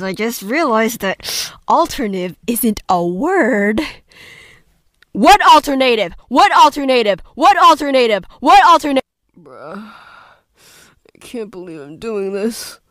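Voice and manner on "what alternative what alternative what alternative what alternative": higher, louder character voice; Yuki voice warm-up. Shown as a demonstration. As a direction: rehearse a loud high anime line. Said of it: "warm up my Yuki voice".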